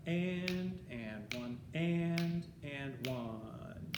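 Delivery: slow syncopated count, long held "and"; rhythmic top-line count. Shown as a demonstration. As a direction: chant it in time, stretching the tied beats. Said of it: "here's how you would, uh, count".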